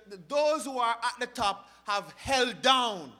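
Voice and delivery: loud, emphatic preacher delivery; forceful preaching. Acted. Preacher to congregation on those held down, forceful preaching. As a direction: drive each phrase home from the pulpit.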